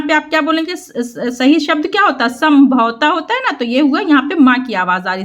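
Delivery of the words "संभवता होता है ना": ordinary delivery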